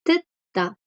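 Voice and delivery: "Teta" said high and carefully in Korean, falling at the end; "Teta" spoken as a teacher's pronunciation model; Korean word demo. Shown as a demonstration. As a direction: model the Korean verb "Teta" slowly and clearly. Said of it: "el verbo escuchar".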